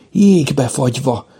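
plain reading voice

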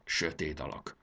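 low, soft, huffy grumble; sulky low aside; offended mumble. A narrator's reading. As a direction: mutter it under your breath, hurt. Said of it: "dünnyögte sértődötten Spiritus".

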